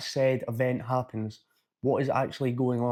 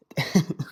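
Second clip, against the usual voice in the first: sudden genuine giggle; real laugh; 3 semitones higher than usual. Real, not acted. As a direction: laugh breaking out after faking one. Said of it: "And a real laugh came out".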